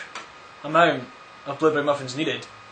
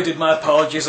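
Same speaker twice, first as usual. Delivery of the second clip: ordinary talk, nothing marked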